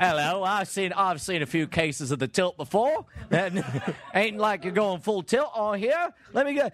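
mock British mystery man; put-on British detective patter; terrible fake British accent. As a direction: ham up a bad British accent. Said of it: "my terrible fake accent".

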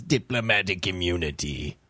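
deep mock South African voice. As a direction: drop the voice low for a fake South African. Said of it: "like, lowering your voice".

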